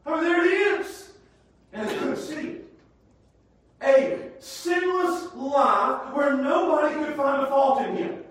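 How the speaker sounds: loud, high preacher's climax; preacher crying out that Jesus is the one; preaching at full force. Acted. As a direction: hammer each line home to the congregation.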